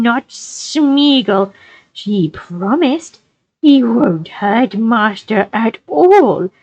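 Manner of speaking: high, slow, strange creature voice, sly and gloating; slow, wheedling and creepy, drop to a whisper near the end; Gollum voice. A narrator's reading. Gollum gloating in Sam's ear as he seizes him, slow creepy voice. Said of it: "hissed Gollum in his ear".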